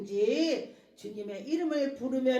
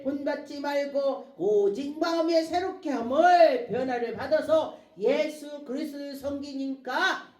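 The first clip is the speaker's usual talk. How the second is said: loud, drawn-out scripture declamation; preaching scripture; 3 semitones higher, 5 dB louder than usual. Acted. Preacher reads scripture to the congregation, declaiming. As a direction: proclaim the verse with long stressed words.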